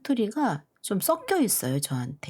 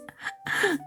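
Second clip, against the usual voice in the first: high airy laugh; a quick amused laugh; breathy laugh; 12 semitones higher than usual. Real, not acted. Laughing at her own dialect imitation.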